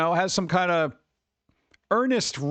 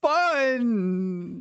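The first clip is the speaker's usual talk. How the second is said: higher, dragged-out fake enthusiasm; forced cheer; 7 semitones higher, much slower than usual. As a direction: sell fun you don't feel, very slow. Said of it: "It feels so forced".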